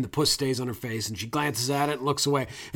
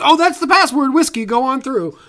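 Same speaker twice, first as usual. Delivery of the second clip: much higher, louder, eager mock delight; delighted guard; 10 semitones higher, 8 dB louder than usual. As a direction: sound thrilled to wave them through. Guard hears the password by chance.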